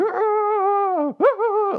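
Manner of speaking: very high, loud manic cackle; bizarre Joker laugh. Retold or imitated. Imitating Nick's unhinged laugh after the tackle, very high manic cackle. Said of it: "Nick starts laughing like the fucking Joker".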